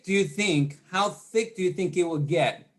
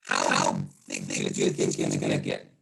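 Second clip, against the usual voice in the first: muffled, distorted Zoom audio, low and dull; muffled mic. Real, not acted. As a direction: sound as if through a broken microphone. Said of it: "I'm coming in muffled".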